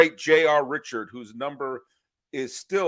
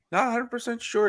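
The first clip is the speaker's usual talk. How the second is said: cool beatnik; 12 semitones higher than usual. Pretend. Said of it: "talking like a, a beatnik?".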